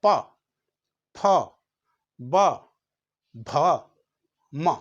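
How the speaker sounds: प, फ, ब, भ, म said with lips closing and opening; slow lip-sound drill; lip letters demo. Shown as a demonstration. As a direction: pop प फ ब भ म off the lips. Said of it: "केवल ओंठों से होता है।".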